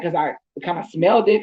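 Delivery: usual speaking voice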